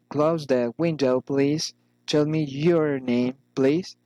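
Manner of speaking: "please" rising at each end; rising intonation. Shown as a demonstration. Teacher models requests, rising intonation on "please". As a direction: lift "please" to soften the command. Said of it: "rising intonation".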